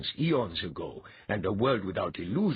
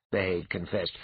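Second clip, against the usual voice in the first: ordinary delivery, nothing marked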